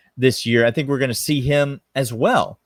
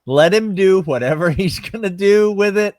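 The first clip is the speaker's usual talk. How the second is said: laughing; 6 semitones higher, 6 dB louder than usual. Real, not acted. Laughing through joke.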